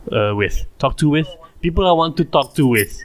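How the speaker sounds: ordinary talk, nothing marked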